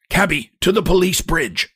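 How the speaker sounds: cracked-voiced hunchback hailing the cab; cracked cry. A narrator's reading. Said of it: "cries in a cracked voice".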